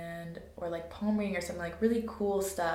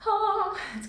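excited; 14 semitones higher, 6 dB louder than usual. Real, not acted. Hyped about rave weekend.

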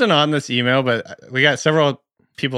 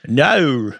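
"No" stretched in an imitated Australian accent; drawn-out Australian "No". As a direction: bend "No" into several Aussie syllables. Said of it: "turn the word no, which is two letters, into, like, three syllables".

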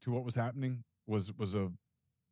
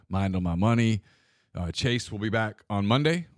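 louder, upbeat host sign-off; podcast outro read; 8 dB louder than usual. Acted. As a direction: wrap up the show like a radio host.

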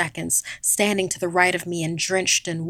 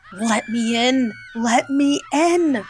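drawn-out shout, rising higher and louder; slow, building pleading shout; desperate yell; 5 semitones higher, 5 dB louder, slower than usual. A narrator's reading. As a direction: yell to get through a locked door. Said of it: "I yelled".

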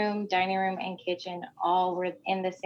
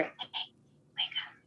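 unvoiced eerie whisper; ghostly whispered wake-up call; faint whisper; 11 dB quieter than usual. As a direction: breathe it barely audible, like a voice in the dark. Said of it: "really faintly, you hear".

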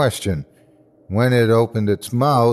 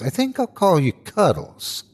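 high, sugary mock baby talk; 7 semitones higher than usual. A narrator's reading. Narrator taunting the monster, mock-sweet and high. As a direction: coo it like naming a pet. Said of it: "I said in a mocking voice".

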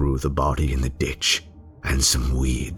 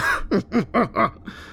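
very high breathless madman's laugh; gleeful mad laugh. Acted. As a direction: laugh at the manhunt, delighted. Killer mocks the manhunt.

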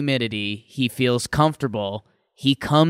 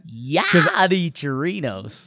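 slow, drawn-out announcer voice; Bob Uecker; slower than usual. Acted. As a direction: stretch the name out like a showy broadcaster. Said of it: "Bob Uecker voice that you say?".

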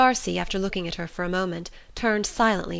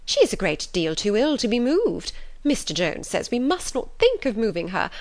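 higher, swooping anxious-mother voice; fussy, insistent mother; 4 semitones higher than usual. Acted. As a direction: plead her case like a fussing mother. Mrs Bennet pleads Jane's illness.

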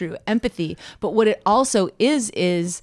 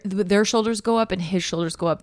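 normal talking voice

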